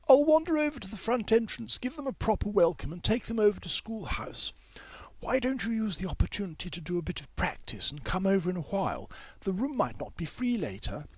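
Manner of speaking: high, genteel schoolmistress; Miss Lomax voice. A narrator's reading. Miss Lomax to May, high schoolmistress voice. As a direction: lilt high like a fussy music teacher.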